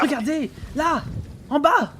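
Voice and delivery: très aigu, pressant, affolé. Acted. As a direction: montre du doigt dans la panique. Matelot montre la chose, affolé. (le matelot, affolé, désigne la proue au capitaine)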